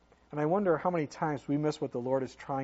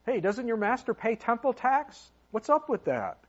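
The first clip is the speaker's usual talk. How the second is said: higher-pitched, mock confrontational questioning; acted challenge; 7 semitones higher, 4 dB louder than usual. Tax collectors questioning Peter, mock challenging.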